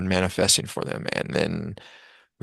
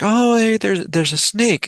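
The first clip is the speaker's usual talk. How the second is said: much higher, louder, delighted discovery; pleasant surprise; 11 semitones higher, 4 dB louder, slower than usual. Retold or imitated. Storyteller voicing villagers suddenly seeing the snake kindly, pleasant surprise. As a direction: greet the snake like an old friend. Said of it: "They're like".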